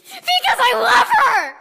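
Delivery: very high, loud, slow cry with a laugh; shrieked. Acted. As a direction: shriek his love defiantly. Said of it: "And then Draco shrieked".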